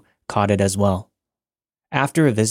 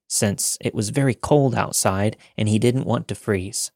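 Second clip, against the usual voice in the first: usual speaking voice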